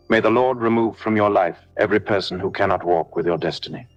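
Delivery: usual speaking voice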